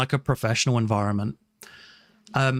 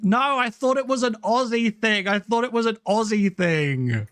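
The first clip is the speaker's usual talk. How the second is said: playful whining protest; 6 semitones higher than usual. Real, not acted. Speaker excusing a missed reference, in a playful wail. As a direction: plead in a mock-embarrassed whine.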